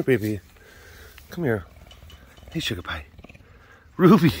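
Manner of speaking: ordinary delivery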